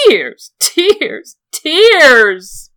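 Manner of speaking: loud, high, sobbing shrieks; hysterical sobbing repeat. Acted. Lysa throwing Littlefinger's word back, loud hysterical sobbing. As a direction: spit each word back through sobs. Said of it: "She sobbed hysterically".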